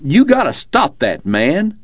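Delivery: high, drawn-out holler; slow high-pitched yell. A narrator's reading. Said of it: "The driver yelled".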